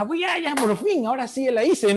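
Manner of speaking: mock thrill. Thrilled as crush approaches.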